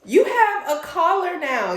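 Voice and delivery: high, loud sweet talk to a dog; cooing to dog. Retold or imitated. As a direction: coo at the dog like a baby.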